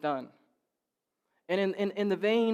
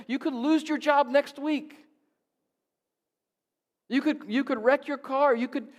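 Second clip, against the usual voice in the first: higher, louder preaching build; full-force preaching; 7 semitones higher, 6 dB louder than usual. Acted. Pastor urging church to trust God, preaching hard. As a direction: drive it home from the pulpit.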